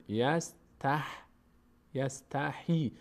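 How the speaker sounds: "یستحیی" broken slowly into yas-tah, yas-ta-hee; wrong kasra on H. Retold or imitated. Teacher imitates how some readers err, h carrying ee. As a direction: slide the h of "یستحیی" into a long ee.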